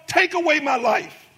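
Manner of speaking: rising, fervent preaching voice. Acted. Pastor preaching Jonah's story to the congregation, fervent.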